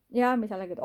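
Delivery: ordinary talk, nothing marked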